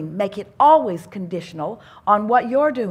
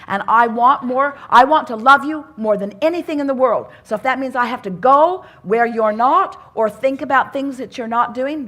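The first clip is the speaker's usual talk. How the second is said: emphatic loving declaration; 3 semitones higher, 7 dB louder than usual. Retold or imitated. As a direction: press the love on them. Parent voiced declaring love.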